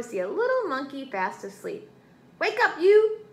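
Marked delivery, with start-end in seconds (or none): 2.4-3.2 high, loud rooster shout; piercing storybook wake-up call; screamed order. A narrator's reading. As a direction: yell to rouse a sleeper. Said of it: "screamed Chicken Chuck".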